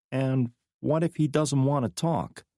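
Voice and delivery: lightly voiced woman, asking; a hint of a wary woman; McKnight's voice. A narrator's reading. McKnight pressing Drake on the prisoner, lightly voiced.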